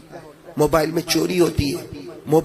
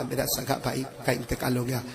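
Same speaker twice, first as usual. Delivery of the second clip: hushed, much softer mock-tearful voice; imitated weeping; 4 semitones lower, 10 dB quieter than usual. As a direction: say it like you're choking back tears. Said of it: "मैंने रोते रोते कहा".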